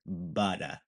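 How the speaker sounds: New England "butter", soft D for T, no final R; New England accent. Shown as a demonstration. Teacher shows the hometown sound, New England "butter". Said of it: "the sound that you want to aim for".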